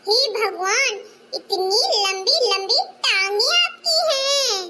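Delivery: put-on high, slow little kid teasing; little child voice. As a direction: play a small child giggling at aunty's short dress. Child speaks to grandma, mocking aunt. (छोटे बच्चे की ऊँची आवाज़)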